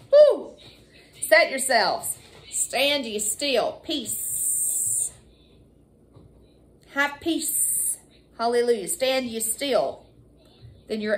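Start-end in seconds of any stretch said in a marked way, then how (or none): 0.1-0.5 high, loud woo; worship shout. Real, not acted. Preacher to church, loud joyful woo. 2.5-3.8 slow, deliberate; slow command. Acted. Preacher to church, slow still command. 3.8-8.0 softer, near-whispered; hushed peace. Acted. Preacher calming the church, hushed. 8.9-10.1 slow, deliberate; slow command. Acted. Preacher to church, slow still command.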